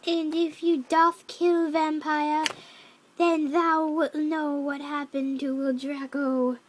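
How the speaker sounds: slow, measured, loud pronouncement; villain's threat. Acted. Voldemort warning Ebony about Draco's fate, slow ominous threat. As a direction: intone each word as an ominous warning.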